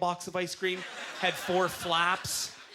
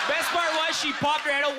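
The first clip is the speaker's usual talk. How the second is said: much higher, shouted while laughing; 9 semitones higher, 4 dB louder than usual. Real, not acted. Telling the crowd the punchline, laughing shout.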